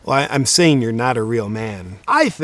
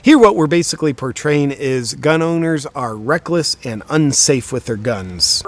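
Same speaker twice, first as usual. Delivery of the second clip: ordinary talk, nothing marked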